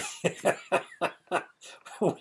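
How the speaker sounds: high, breathy chuckle; real amused laugh at oneself. Real, not acted. Laughs at own remark about age.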